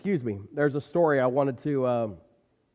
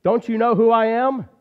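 indignant donkey, higher and louder; 6 semitones higher, 6 dB louder than usual. A narrator's reading. Donkey demanding the townspeople recognize him, indignant. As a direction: demand it, wounded pride.

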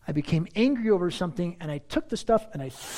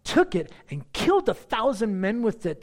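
raised, emphatic Bible reading from the pulpit; scripture reading; 3 semitones higher than usual. A narrator's reading. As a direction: read the story out with animated quotes.

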